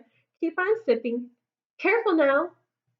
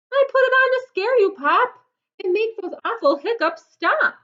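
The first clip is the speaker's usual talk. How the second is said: fast, higher, louder excited rush; eager rush; 9 semitones higher, 4 dB louder, faster than usual. Acted. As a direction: blurt it out in a hurry. Brother explains the scare.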